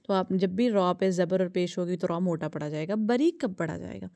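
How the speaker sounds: ordinary delivery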